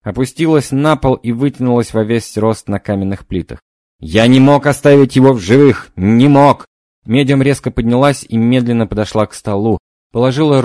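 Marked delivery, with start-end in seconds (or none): 4.0-6.6 loud anguished outburst; desperate cry. Acted. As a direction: cry out the guilty confession. Possessed confession.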